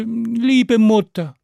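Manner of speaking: high, slow wheedling; pleading second child. A narrator's reading. Second child begging mother for pancake, high slow pleading. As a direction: coax mother like a hungry child. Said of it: "Das zweite Kind sagte".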